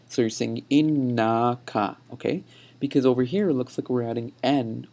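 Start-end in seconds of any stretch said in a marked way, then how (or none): 0.7-2.0 slow "innaka" with held n and long na; awe vowel. Shown as a demonstration. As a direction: stretch the middle of innaka, "in-naw-ka". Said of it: "putting A W E there".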